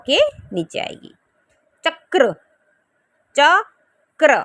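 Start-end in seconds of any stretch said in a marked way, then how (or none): 1.8-2.3 चक्र, split into च and क्र; slow clear chakra. Shown as a demonstration. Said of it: "ठीक है, चक्र।". 3.4-4.4 चक्र, stretched slowly and louder; drawn-out chakra. Shown as a demonstration. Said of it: "ठीक है, चक्र।".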